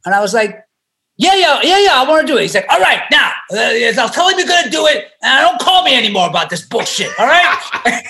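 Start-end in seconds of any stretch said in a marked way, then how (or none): 1.2-2.4 high, loud, rushed yeses; young actor jumping at the part; eager yes. Retold or imitated. 2.7-5.1 gruff mock manager. Manager barking on the phone. 5.2-8.0 laughing through the manager's telling-off; amused retelling of the scolding; laughing mock scold. Manager ending the call.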